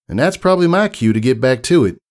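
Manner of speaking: Southern country mare. Acted. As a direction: give it a down-home Southern twang. Applejack voiced in story.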